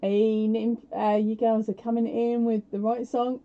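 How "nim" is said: ordinary talk, nothing marked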